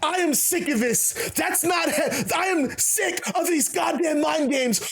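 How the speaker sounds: fed up, bellow every word; angry shouting. Retold or imitated. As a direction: Juice shouting at Sakamoto, sick of mind games. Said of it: "And Juice is".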